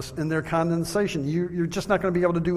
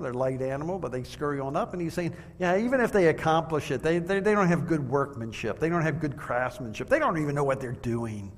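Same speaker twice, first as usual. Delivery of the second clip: ordinary delivery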